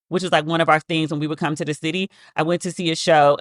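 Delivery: ordinary talk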